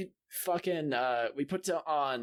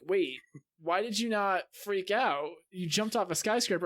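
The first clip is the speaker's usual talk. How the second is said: higher-pitched, incredulous retold question; retold disbelief; 6 semitones higher than usual. Player retelling onlookers' reaction to his VR jump, mock disbelief. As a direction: imitate baffled onlookers, pitch climbing.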